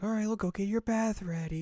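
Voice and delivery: high-pitched mother voice; a high, lilting motherly offer; doting mom. Retold or imitated. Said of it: "Her mom's like".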